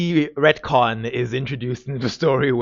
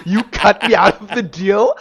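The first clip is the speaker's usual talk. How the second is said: high, loud, laughing disbelief; mock outrage, rising on me; shocked Selene; 7 semitones higher, 5 dB louder than usual. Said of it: "She's like".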